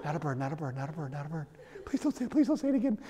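father lying in bed afraid, begging under his breath; scared muttering. Retold or imitated. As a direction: murmur it like a frightened prayer. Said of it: "now I'm laying in bed like this".

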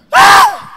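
sharp high yelp of pain; child's scream. Retold or imitated. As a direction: scream like a kid being whooped. Kid screaming when hit.

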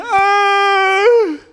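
put-on high whiny child's "oh"; granddaughter's fake cry; mock whimper. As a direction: whimper like a little girl. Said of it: "granddaughter's whimper".

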